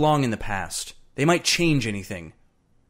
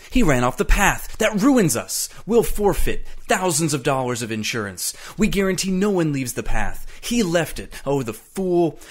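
agitated, higher-pitched tirade; angry rant; 6 semitones higher than usual. A narrator's reading. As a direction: rail at the fool who ruined everything. Travis rants at Eccles's mistake.

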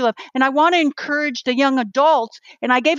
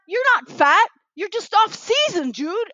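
higher, bright reassurance to a friend; upbeat pep talk; 8 semitones higher, slower than usual. Retold or imitated. Cheering up a guy who says he's fat, pitched high. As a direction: lift him up, pitch raised.

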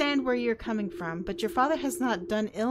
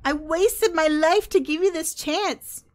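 pained plea; 5 semitones higher, 6 dB louder than usual. Acted. Dad pleads with daughter.

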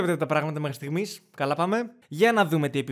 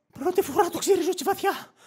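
high, animated sidekick voice; cartoon character voice. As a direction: put on a high-pitched cartoon voice. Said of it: "μπορώ να κάνω τη φωνή του". (ψιλή φωνή καρτούν)

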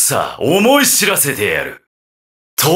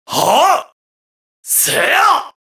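high, short striking yells; sharp rising combat shouts; attack cries; 14 semitones higher, much slower than usual. Acted. Game fighter striking an enemy, high short yells.

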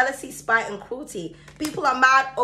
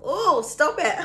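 squealing laugh at herself; high laugh; 8 semitones higher, 3 dB louder, slower than usual. Real, not acted. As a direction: crack up, then giggle "stop it". Laughing at her own voice.

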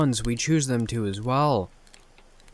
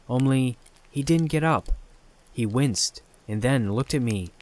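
usual speaking voice

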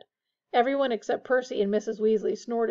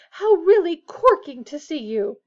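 loud, higher, drawn-out mock delight; mock-posh gushing; 6 semitones higher, 9 dB louder than usual. A narrator's reading. Fred seizes his mother's hand, mock-posh gushing. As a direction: overdo the formal greeting slowly. Fred mocks formal greeting.

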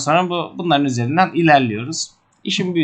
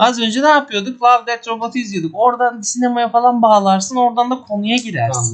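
much higher, bright mock show-host intro; abartılı sunucu girişi; 9 semitones higher than usual. Shown as a demonstration. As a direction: sell it like a cheesy TV host. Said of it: "organik bir şekilde girmemiz gerekiyor". (yüksek perdeden yapay sunucu sesi)

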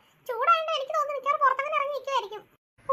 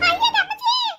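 frightened shriek; 8 semitones higher, 4 dB louder than usual. Acted. Cries out in fright.

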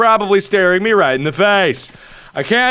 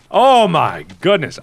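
usual speaking voice

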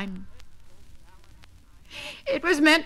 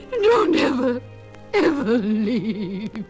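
crying plea; slower than usual. Acted. Spinster begging Agatha never to leave, sobbing. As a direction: beg through tears.

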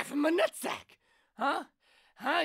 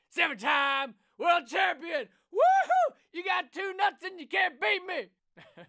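shouted character rant; 4 semitones higher, 5 dB louder than usual. Acted.

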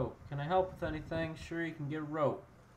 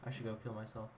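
low, fast, much softer self-put-down; embarrassed mumble; 6 semitones lower, 12 dB quieter, faster than usual. Real, not acted. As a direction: trail off in shame. Ashamed of bad joke.

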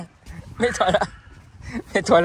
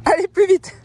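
higher, louder push to go faster; high urging; 12 semitones higher, 4 dB louder, slower than usual. Real, not acted. Owner urging Kayla to go faster, high and loud. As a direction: urge her on, pitched way up.

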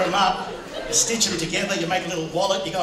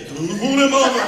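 cartoon bear voice rising and getting louder; mock secret talk as a cartoon bear; Yogi Bear; 5 semitones higher, 7 dB louder, faster than usual. Greeks speaking secretly by the pool, Yogi Bear voice. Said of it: "we sound like Yogi Bear".

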